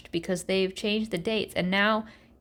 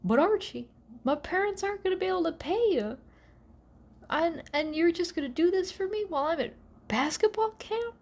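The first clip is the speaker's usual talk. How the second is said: Reggie; 7 semitones higher, faster than usual. Retold or imitated. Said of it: "Reggie's like".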